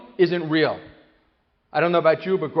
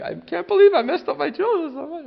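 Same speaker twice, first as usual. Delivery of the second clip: much higher, louder anguished self-reproach; dismayed parent; 10 semitones higher, 5 dB louder than usual. Retold or imitated. Guilty parent thinking aloud: hammed-up high dismay. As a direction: wail it in mock despair.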